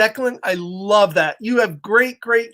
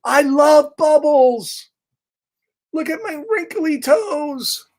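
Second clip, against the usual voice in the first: higher, slow, delighted bird voice; blissful pigeon; 7 semitones higher, slower than usual. Acted. Pigeon enjoying the bath, slow delighted voice. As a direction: savour every bubble.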